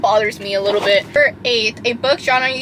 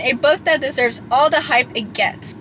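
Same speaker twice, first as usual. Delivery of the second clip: ordinary talk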